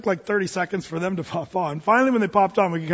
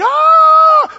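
sudden high scream of fright from the crowd; panicked scream; 13 dB louder than usual. Retold or imitated. Passengers panicking in a dark train, screaming. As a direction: shriek like the lights just blew out. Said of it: "freaking out".

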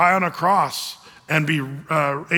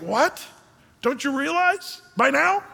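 mock-exasperated, pitched up and drawn out slowly; 8 semitones higher, slower than usual. Jesus, exasperated with Philip, mildly pitched-up disbelief. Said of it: "he's almost exasperated".

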